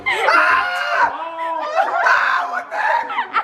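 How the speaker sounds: high, laughing shriek; shrieking at the alligator as he grabs his plaque; shocked laughing yelp. Real, not acted.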